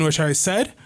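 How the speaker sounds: usual speaking voice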